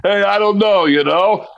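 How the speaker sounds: slurred action-star impression; hammy impressionist handing off to another voice; mock tough-guy celebrity voice; Sylvester Stallone. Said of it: "doing Stallone".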